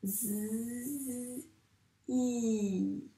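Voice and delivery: "Z, I" as a long soft buzz, then a held ee; sounding out letters. Shown as a demonstration. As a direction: hold each sound separately in "Z, I". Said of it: "bien dire tous les sons".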